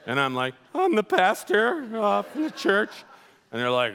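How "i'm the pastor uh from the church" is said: high, hesitant, drawn-out answer; mock-meek reply to intimidating bikers; timid pastor. Nervous pastor answers the tough bikers.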